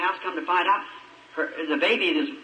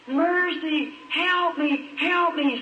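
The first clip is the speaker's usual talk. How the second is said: retold scream for mercy; 10 semitones higher, much slower than usual. Preacher imitating a flooded mother's high, mild mock scream for help. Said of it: "just screaming".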